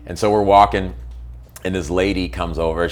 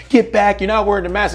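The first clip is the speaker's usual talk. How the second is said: much higher, louder mock yell; retold angry shout; 11 semitones higher, 3 dB louder than usual. Imitating the husband shouting back at the narrator. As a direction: bark it like the husband losing it. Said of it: "he's like".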